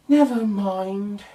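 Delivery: slow, sighing resignation. Acted. Little Bear sighs to Duck, slow and resigned. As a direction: let it go with a sigh. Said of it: "said Little Bear with a sigh".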